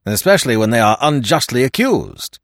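ordinary delivery

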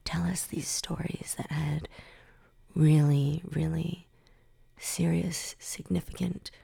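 slow, hushed gravity with pauses; low and weighty, word by word; mock solemn. Describing a very serious rabbi's stories, mock solemn.

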